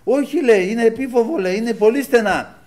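high, loud mock protest; imitated shouting, pitched well up; retold yelling neighbour. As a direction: argue back like an agitated neighbour. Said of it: "Και φώναζε ο γείτονας".

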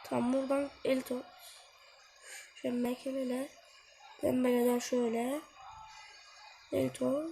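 normal talking voice

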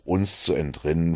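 ordinary delivery, nothing marked

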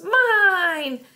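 put-on very high, drawn-out toddler screech. A narrator's reading. Toddler screaming over her cookie, screeched. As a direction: screech it like a furious small child. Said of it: "screeched Boots".

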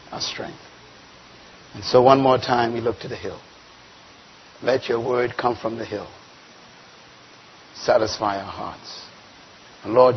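prayer. Acted. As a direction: pray it softly and reverently. Leads the opening prayer.

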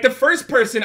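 ordinary talk, nothing marked

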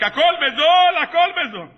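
high, stretched-out market cry; imitated hawker calling loudly; street vendor's shout. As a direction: hawk cheap goods to the crowd. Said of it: "והוא צועק".

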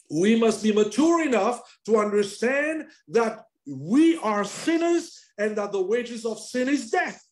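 high, loud proclamation, up to a shout; shouting preacher. Acted. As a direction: proclaim it over the whole church. Preacher urging sinners to repentance.

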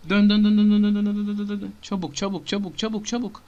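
high, loud hurried urging; urgent shouting. Real, not acted. Gamer urging his character to turn and hurry.

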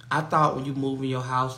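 ordinary talk, nothing marked

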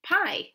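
"Pie" said brightly by itself, high and punched; isolated syllable. Shown as a demonstration. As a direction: lift "Pie" out as the first syllable. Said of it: "What is our first syllable?".

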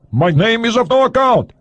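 put-on high, Russian-accented curt reply; proud Soviet agent. A narrator's reading. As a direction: dismiss it with pride, pitched high. Agent answers.

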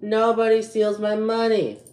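high, stubborn vow; defiant anger. Real, not acted. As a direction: lay down the law. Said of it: "I should not be acting like this".

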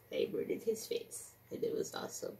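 softly whispered boast; gleeful whisper. Acted. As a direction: whisper it like a delicious secret.

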